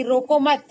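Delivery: "रोको मत" loud and joined; no break before मत, stop right after it; pause after मत. Shown as a demonstration. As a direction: punch "रोको मत" as one unit. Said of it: "मत के बाद मैंने कॉमा लगाया".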